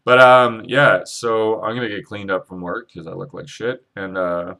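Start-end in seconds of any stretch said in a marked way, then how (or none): none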